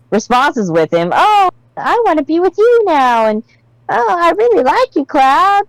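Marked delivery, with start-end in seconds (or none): 1.1-3.3 put-on high, eager lovestruck girl; lightly voiced eager crush; gushing Aerith. 3.9-5.6 put-on high, eager lovestruck girl; lightly voiced eager crush; gushing Aerith.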